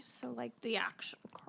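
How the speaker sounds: quiet whispered aside. Real, not acted. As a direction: whisper it under your breath.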